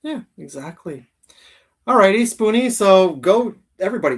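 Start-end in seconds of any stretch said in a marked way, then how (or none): 1.9-4.2 big, loud, excited call to the audience; loud hype. Real, not acted. Host loudly rallying viewers to subscribe, excited. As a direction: pump the viewers up, nearly shouting.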